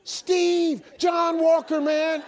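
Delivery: high, shouted cheerful greeting; John Walker. Retold or imitated. Sponsor on yet another morning call, exuberant. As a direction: burst in with relentless cheer. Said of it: "he called me the exact same way".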